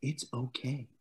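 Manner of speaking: lightly imitating a calm coach; a hint of soothing calm; mock calm. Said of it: "It was just like".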